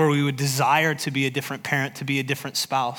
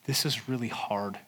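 humble quiet admission; 3 semitones lower, 7 dB quieter than usual. Acted. Sermon voicing Humility's confession.

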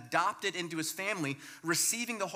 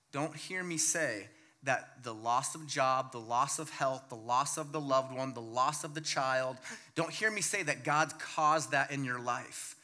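ordinary delivery

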